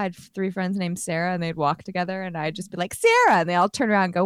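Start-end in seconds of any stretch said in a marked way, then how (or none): 2.9-3.4 loud high call across a hallway. Retold or imitated. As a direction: call her name out loud. Calling a friend across school.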